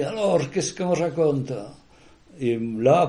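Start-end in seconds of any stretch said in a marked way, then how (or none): 0.0-1.5 slow, lightly voiced friendly greeting; a hint of old Serge asking the news; imitating Serge's greeting. Teller echoes his friend's usual greeting, lightly voiced. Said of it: "il me disait".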